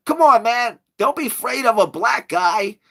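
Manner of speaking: mock street hustler coaxing tourists; hustler sales pitch.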